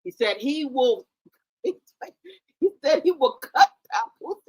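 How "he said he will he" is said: normal talking voice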